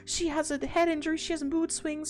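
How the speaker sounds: high, flustered mock dad voice; apologetic dad. Danny excusing his daughter to Alan, high and flustered. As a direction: fumble the excuse like an embarrassed father. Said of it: "And Danny's like".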